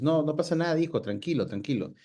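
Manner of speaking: ordinary talk, nothing marked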